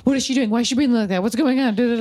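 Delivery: high, loud, fretting mom voice; anxious helicopter mom. Retold or imitated. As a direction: fuss like a worried hovering mother. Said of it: "constantly be like".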